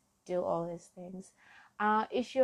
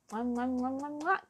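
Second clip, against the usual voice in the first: kisses; 4 semitones higher than usual. Real, not acted. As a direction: blow kisses into the mic. Blowing kisses to listeners.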